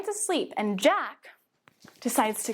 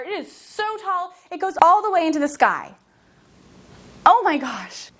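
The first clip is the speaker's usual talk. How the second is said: excited awe; 6 semitones higher, 7 dB louder than usual. Acted. Awed storytelling to children.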